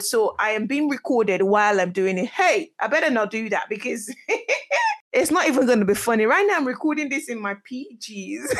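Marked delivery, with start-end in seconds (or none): 4.2-5.1 high laugh. Real, not acted. Laughing at recording idea.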